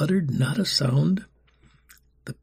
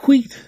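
1 whistle call imitation; 12 semitones higher, 9 dB louder than usual. A narrator's reading. Whistle signal in the courtyard.

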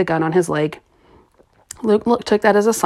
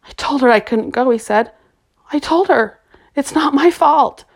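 pleading; 7 semitones higher than usual. A narrator's reading. Boy pleading guilt over Jen's death.

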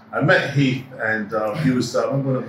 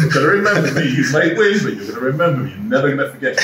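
mock threat; 3 semitones higher, 5 dB louder, faster than usual. Laughing menace at interviewer.

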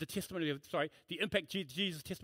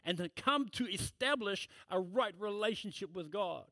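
preaching; 3 semitones higher than usual. Acted. Pastor proclaiming Christ to his congregation, fervent preaching. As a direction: preach it with full conviction from the pulpit.

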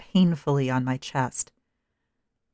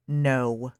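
annoyed; 3 semitones lower than usual. A narrator's reading. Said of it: "the voice continued, annoyed".

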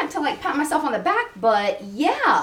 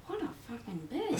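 much softer, hushed mock insult; imitating stranger's thought; 18 dB quieter than usual. Voicing what strangers think of her, hushed. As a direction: drop almost to nothing. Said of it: "you're like".

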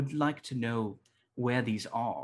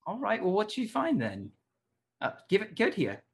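higher, louder, hurried professor voice; impatient Tucker; 10 semitones higher, 4 dB louder than usual. Acted. Professor Tucker demanding the cube from the students, higher and louder. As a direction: snap your fingers for it, impatient.

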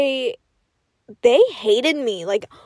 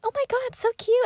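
imitating gushing teacher; 11 semitones higher than usual. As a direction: coo it in a high, small voice. Teacher gushing over llama onesie.

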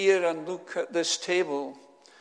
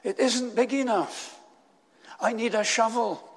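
raised, higher-pitched storytelling protest; protesting cry; 5 semitones higher, 4 dB louder than usual. A narrator's reading. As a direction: call it out as an urgent objection. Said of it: "he cried".

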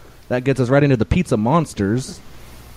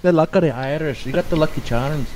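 put-on higher, lilting Irish accent; mock Irish lilt, pitched up; 5 semitones higher than usual. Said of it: "an episode about Irish accents".

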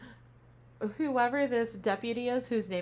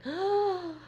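a sudden high gasp of shock; sharp gasp; 10 semitones higher, 3 dB louder than usual. Retold or imitated. Gasping at the hat lifting to show scary eyes.

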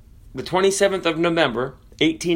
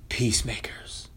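whisper; 4 semitones lower, 10 dB quieter than usual. Acted. Preacher whispers key word.

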